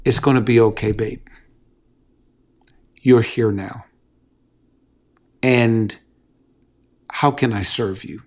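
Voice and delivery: soft, low and tender; gentle reassurance. Shown as a demonstration. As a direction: comfort her softly. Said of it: "and just simply say".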